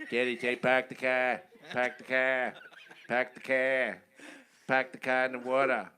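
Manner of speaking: hammed-up Boston Brahmin Kennedy impression; Kennedy accent. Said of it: "Never heard that accent".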